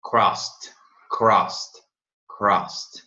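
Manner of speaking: slow repeated crossed; English drill. Shown as a demonstration. Teacher drilling crossed for students. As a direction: say crossed three times with a rolled r. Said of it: "Ele, cruzou a ele".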